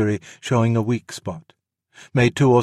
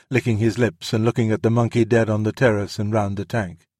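ordinary delivery, nothing marked

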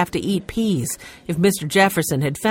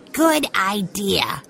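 put-on slow child voice; 3 semitones higher, slower than usual. A narrator's reading. Benny voiced in read story.

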